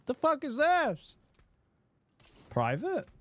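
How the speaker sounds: high-pitched mock bewilderment; baffled stranger. Streamer imagining someone wrongly sent the message, high baffled voice.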